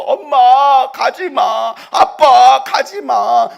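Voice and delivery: very slow, loud and high pleading; children's pleading shout. Retold or imitated. Preacher voicing children begging mom and dad not to leave, shouting. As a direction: cry out like a child clinging.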